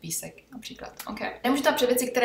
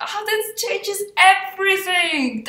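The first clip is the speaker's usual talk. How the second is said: higher, louder mock gasp of revelation; mock discovery; 9 semitones higher, 7 dB louder, slower than usual. Teacher hamming up a mock eureka moment. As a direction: play a dramatic eureka.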